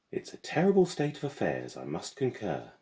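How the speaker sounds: high, lofty headmistress voice; pompous headmistress. A narrator's reading. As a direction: lament it like a grand old schoolmarm.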